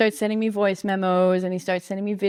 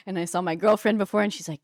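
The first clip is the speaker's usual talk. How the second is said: ordinary delivery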